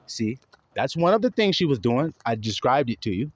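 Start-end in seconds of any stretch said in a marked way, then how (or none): none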